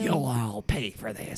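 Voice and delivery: low, slow villain threat; slow theatrical villain menace. Acted. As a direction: growl it like a cartoon villain. Repeated revenge vow.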